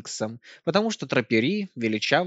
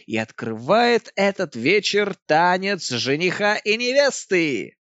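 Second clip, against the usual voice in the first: excited announcement; 6 semitones higher, 5 dB louder than usual. Acted. Singer announces first dance.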